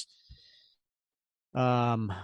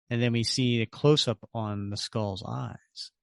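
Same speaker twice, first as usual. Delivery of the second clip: normal talking voice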